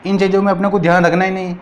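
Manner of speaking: ordinary delivery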